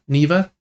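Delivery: Neva said as NEE-vuh, questioning; long-e pronunciation. Shown as a demonstration. Last guess at the game's name, Neva as NEE-vuh. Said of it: "couldn't tell how she was pronouncing it".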